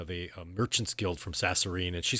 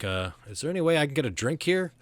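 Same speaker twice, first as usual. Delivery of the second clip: lightly voiced half-elf, higher pitched; half-elf customer; 7 semitones higher, faster than usual. Half-elf asks the group at the bar, lightly voiced customer. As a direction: ask for a drink a little higher. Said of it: "she's like".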